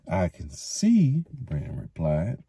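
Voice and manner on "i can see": drawn out, knowing; slow and pointed. A narrator's reading. Mayor eyeing the thatcher, slow and pointed. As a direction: let it hang meaningfully. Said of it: "with a sidelong look".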